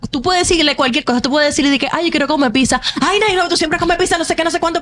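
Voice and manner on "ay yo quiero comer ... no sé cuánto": high, whiny quoted friend; mock her defensive snapping; imitating an irritated friend, pitched up; mimicking a friend's complaint. Acting Jesuani's snappy complaint.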